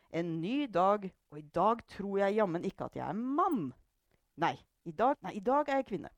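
lightly hammed-up little scene; play-acted waking up. A narrator's reading. Mother performing for her family, mildly theatrical. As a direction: act it out for an audience. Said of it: "begynte hun å spille opp en scene".

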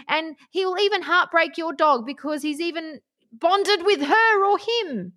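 high-pitched, impassioned tirade; worked-up rant. Real, not acted. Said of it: "calm down".

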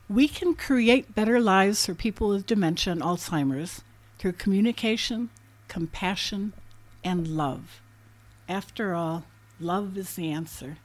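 reading aloud. Acted. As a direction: read it out like a heartfelt quote. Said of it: "I'd like to read to our readers now".

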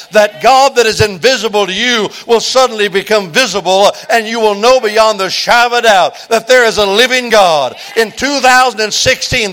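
loud, high, ringing altar-call preaching with the words punched out; full-force preaching. Acted. Pastor calling the congregation to the altar, loud and fervent preaching. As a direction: preach it out, building to a roaring invitation.

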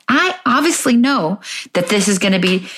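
ordinary talk

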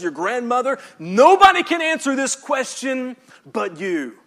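loud, emphatic sermon delivery; full-force preaching. Acted.

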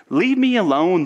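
loud, high outburst; exasperated. Acted. Scrooge dismissing his nephew from the argument, exasperated. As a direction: burst out to get rid of him. Acted quarrel, sending him off.

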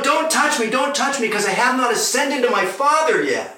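high-pitched acted protest; urgent warning. Jesus to Mary clinging at his feet, urgent warning.

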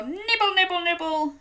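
playful giraffe munch; giraffe nibbling. Shown as a demonstration. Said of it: "and go".

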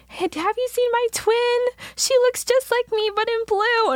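very high imitation of a friend calling; high, airy mock calling; calling out searchingly. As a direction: ask passers-by in a sky-high voice. Overheard friend calling out for twin.

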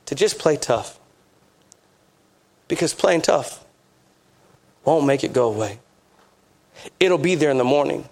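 grave, pastoral appeal in a low register; solemn, tender urging. Real, not acted. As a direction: drop low and let each phrase settle. Preacher gently urges hurting listeners.

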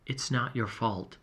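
hushed comfort, barely voiced; soft whisper. A narrator's reading. Friend whispering comfort into his ear, hushed. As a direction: softly, close to the ear. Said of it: "she whispered again in my ear".

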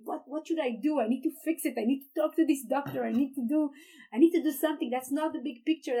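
retold urgent thoughts. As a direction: press each "I need to" urgently, reliving the panic.

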